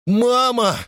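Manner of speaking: put-on high child's cry for help; sharp high call of alarm; frightened cry. A narrator's reading. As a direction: call out for mother in panic. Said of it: "крикнул он".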